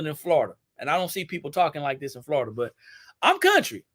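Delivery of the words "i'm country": playing up a Southern country voice, pitched higher; country Southern accent. Shown as a demonstration. Showing viewers her Louisiana roots, mock country accent. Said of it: "my country twang".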